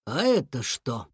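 squinting dog. A narrator's reading. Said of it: "прищурился Фуфу".

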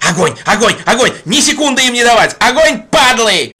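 repeated frenzied fire orders; shouted command. Acted. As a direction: yell fire over and over.

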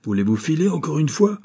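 crié plus haut, exaspéré; cri irrité. A narrator's reading. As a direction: chasse l'importun en criant. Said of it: "cria la voix". (vieillard crie à l'intrus, exaspéré)

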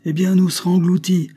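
high, loud defiance; defiant retort. A narrator's reading. Wilfrid answering those who warn him, defiant. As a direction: fling the warning straight back.

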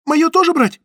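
high, loud squeak of a question; frightened woman squeaking a question. A narrator's reading. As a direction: squeak it out in alarm. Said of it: "пискнула Зоя".

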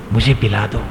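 soft, thirsty plea, acted; weak plea. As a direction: beg quietly as if parched. प्यासा उतंग पानी मांगता है. (प्यास से धीमी गुहार)

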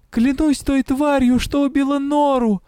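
high shouted oath; shouting. A narrator's reading. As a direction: cry it out. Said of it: "выкрикнула я".

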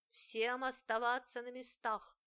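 booming voice. Acted. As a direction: boom the order from above. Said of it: "громыхнул голос".

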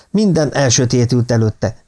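usual speaking voice

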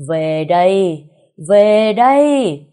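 drawn-out, booming ritual cry, rising at the end; mighty summoning call. A narrator's reading. Shaman summoning a lost soul, booming cry. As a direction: call the spirit back with full power. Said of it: "tiếng hô đầy uy lực của thầy Chứ".

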